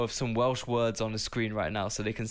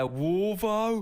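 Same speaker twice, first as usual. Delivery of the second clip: local twang; 7 semitones higher than usual. Retold or imitated. Local nickname for Wolverhampton.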